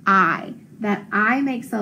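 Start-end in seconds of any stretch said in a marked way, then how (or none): 0.1-0.5 "I" held as a long vowel; long I sound. Shown as a demonstration. Teacher showing the sound of IGH, long I. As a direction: sing out the long "I". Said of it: "It just says the sound".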